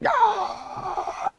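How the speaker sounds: high rasping yell; yell at drivers. Retold or imitated. Driving on the motorway blasting metal, high yell. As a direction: scream along at the other drivers. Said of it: "I'm gonna be like".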